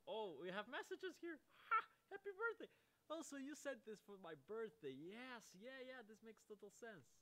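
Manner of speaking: excited. Real, not acted. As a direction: gush over the messages. Reading the birthday messages excitedly.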